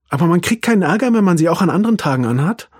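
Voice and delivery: put-on high, fast eager boy; child voice. A narrator's reading. Arthur verhandelt mit dem Opa, hoch und schnell. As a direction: quickly probe for a loophole.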